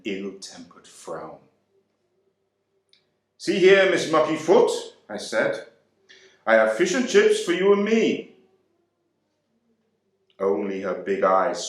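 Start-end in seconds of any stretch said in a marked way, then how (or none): 3.4-8.4 louder, higher, cheeky address to a sulking wife; calling out to her. A narrator's reading. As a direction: announce the food boldly to snap her out of it. Husband addressing his sulking wife.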